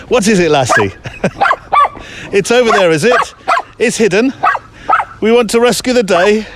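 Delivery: high sing-song voice for a dog; high dog talk. Real, not acted. Talking to dog like Lassie.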